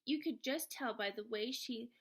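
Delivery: ordinary delivery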